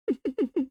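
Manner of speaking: high, staccato acted chuckle; mock villain chuckle. Hollow Ichigo chuckles at Byakuya, acted menacing chuckle. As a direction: imitate a sinister character's chuckle. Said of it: "Hollow Ichigo just chuckles".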